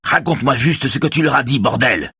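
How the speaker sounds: high, exasperated demand; shouting. A narrator's reading. Raphaël orders the helmsman to talk, exasperated shout. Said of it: "crie Raphaël".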